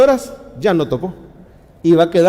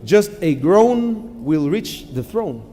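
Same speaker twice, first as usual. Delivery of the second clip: slow and deliberate, each word separated; slow quoted English; much slower than usual. Retold or imitated. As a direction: lay it down slowly like a quoted maxim. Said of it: "Dice un pastor en Estados Unidos". (cita lenta en inglés)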